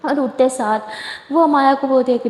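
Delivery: ordinary delivery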